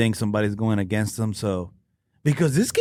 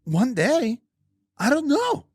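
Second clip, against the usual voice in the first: higher, lightly voiced bewildered guy; retelling the guy's story; 11 semitones higher than usual. Friend retelling the accuser's claim in a lightly higher voice. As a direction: tell it as the confused accuser, a bit higher. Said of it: "So he's like".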